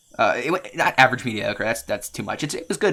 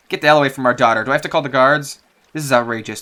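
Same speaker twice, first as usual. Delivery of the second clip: louder furious order; angry parent; 6 dB louder than usual. Acted. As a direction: chase the stranger off. Parent orders stranger away.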